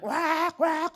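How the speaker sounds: high squawking "Wak, wak" like an angry parrot; mock parrot screech. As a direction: screech it out like a bird. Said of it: "Selalu teriak".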